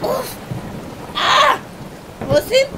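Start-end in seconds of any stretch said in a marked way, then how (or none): 1.2-1.6 loud, high "ah"; sudden shocked yell; startled cry. Acted. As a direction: cry out in alarm. Waking bound at sea.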